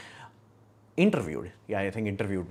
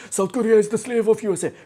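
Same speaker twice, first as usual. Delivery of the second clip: much higher and louder mock voice; mocking critic voice; 10 semitones higher, 8 dB louder than usual. A critic calling South Korea a slave, mockingly high and loud. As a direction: parody the critic in a shrill-free high mocking pitch. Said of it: "And you're saying".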